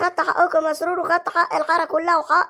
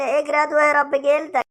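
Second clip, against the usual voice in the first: normal talking voice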